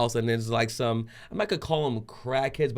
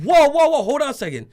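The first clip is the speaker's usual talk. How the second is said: loud, high urgent whoas; acted panicky calling-out; alarmed intervention; 9 dB louder than usual. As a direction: jump in to stop a fight. Stopping pastor about to hit someone.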